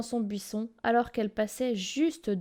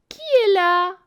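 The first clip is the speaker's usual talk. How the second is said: put-on quavering old voice; 8 semitones higher, 8 dB louder, slower than usual. A narrator's reading. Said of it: "petite voix toute vieille et chevrotante".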